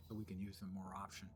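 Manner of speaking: whispering. Real, not acted. Unvoiced aside.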